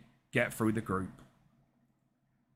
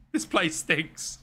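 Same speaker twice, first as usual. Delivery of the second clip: mock disgust; 4 semitones higher, much slower than usual. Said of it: "I was like".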